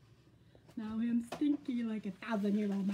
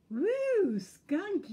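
high, loud, swooping disgust; deer; 9 semitones higher, 8 dB louder than usual. A narrator's reading. Deer smells Skunk, loud high disgust. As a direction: whoop it out in revulsion. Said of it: "said the deer".

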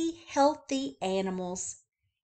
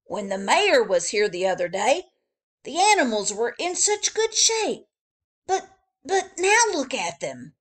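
higher, louder worried zookeeper; fretting zookeeper; 4 semitones higher, 6 dB louder than usual. Acted. Zookeeper complaining to Joe about the animals, higher and louder. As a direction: stammer out the chief's dismay. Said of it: "he said".

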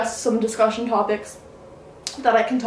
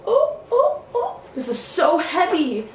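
surprised cry; 8 semitones higher than usual. Real, not acted. Lifting the heavy food container.

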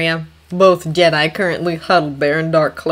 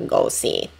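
monotone droid voice; 7 semitones lower, 4 dB quieter than usual. Acted. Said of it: "monotone, metallic voice".

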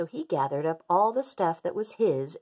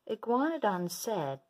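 ordinary delivery, nothing marked

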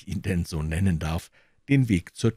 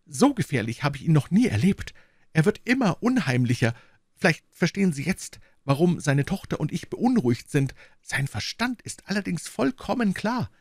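put-on high, anxious young secretary; worried assistant; 10 semitones higher than usual. A narrator's reading. Bennet anxiously telling Holmes about the professor, high. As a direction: confide the fear.

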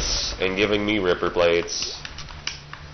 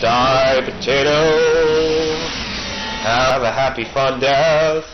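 shouted high mock villain taunt; hammed-up gleeful battle shout; battle cry; 4 semitones higher, 5 dB louder, slower than usual. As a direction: yell it gleefully at the enemies.

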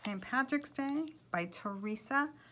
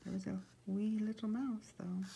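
whispering; 9 dB quieter than usual. Real, not acted. Aside to listeners while reading.

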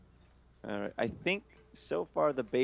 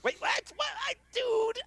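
shocked shout; 4 dB louder than usual. Real, not acted. Shocked by loss.

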